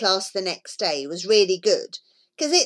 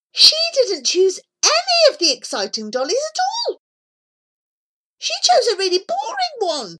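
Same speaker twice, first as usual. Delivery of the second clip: indignant; 11 semitones higher, faster than usual. Acted. Child outraged at teacher's choice.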